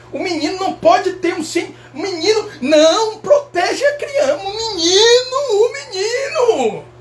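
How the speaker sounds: high, slow whiny parent voice; mocking overprotective parent. Mimicking parents who spoil their boy, high whine.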